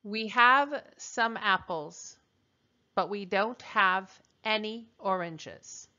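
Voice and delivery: slow, deliberate prompt for translating; slow dictation. Shown as a demonstration. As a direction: read it out slowly for the class.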